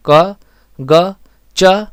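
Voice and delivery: क, ग, च as brief separate syllables; short vowel demo. Shown as a demonstration. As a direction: sound out क, ग, च one by one with short vowels. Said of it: "आप बोल कर देखिए".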